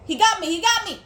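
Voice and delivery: loud, high cry of being caught; frantic alarm; panicked yelling. Real, not acted. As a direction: shout it in panic. Caught by enemy in game.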